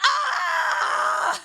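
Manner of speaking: high, loud laughing cry; relieved shout. Retold or imitated. Collapsing after the take, a high laughing shout. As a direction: let it all out on the floor. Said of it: "I'm like".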